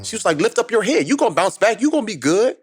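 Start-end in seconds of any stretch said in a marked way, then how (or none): 0.4-1.1 higher, motherly voice lifting him up; encouraging mom. Retold or imitated. Son imitating his mom encouraging him, higher and motherly. 1.1-2.6 higher, motherly voice lifting him up; encouraging mom. Retold or imitated. Son imitating his mom encouraging him, higher and motherly.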